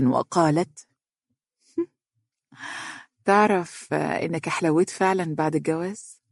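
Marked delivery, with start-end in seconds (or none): 1.8-6.2 soft teasing flirt, a little quieter; flirty smiling woman. A narrator's reading. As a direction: smile through a coy compliment. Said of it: "وهي تنظر إليه مبتسمة". (مغازلة بابتسامة)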